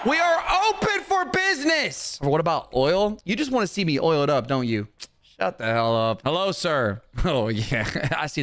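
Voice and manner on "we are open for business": calling out. Acted. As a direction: shout the sale to the whole store. Calling out the sale.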